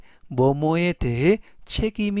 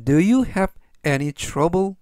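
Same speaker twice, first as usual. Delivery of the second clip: slow model sentence; much slower than usual. Shown as a demonstration. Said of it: "전체적 의미는".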